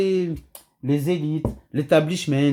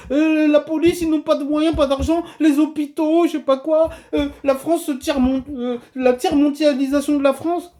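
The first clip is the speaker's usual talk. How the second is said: high, mock-tearful complaining; mock whining; 7 semitones higher than usual. Vlogger mimicking those always crying poverty, mock whining. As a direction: imitate people moaning about having no money. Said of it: "On est là toujours à pleurer".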